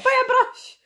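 high, loud shriek of laughter; laughing shout. Real, not acted. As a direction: crack up while blurting it out. Cracking up at shared joke.

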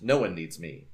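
plain reading voice

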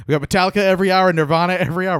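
loud, rapid-fire station promo; mock radio DJ. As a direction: belt it out like a rock-radio jock. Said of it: "this morning radio I'm doing".

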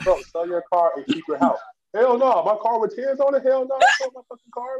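high, hammed-up indignant refusal; mock character argument. Character refusing to sell his car, hammed-up high indignation. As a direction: play the car owner scoffing at the advice, high.

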